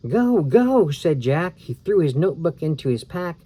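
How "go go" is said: loud, high urgent yell; urgent shout. Acted. Boy urging sister to flee, loud shout. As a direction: shout to hurry her away.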